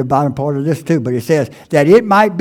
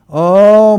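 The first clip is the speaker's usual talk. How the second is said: mock groan; 4 semitones higher, 6 dB louder than usual. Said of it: "we've all done it probably".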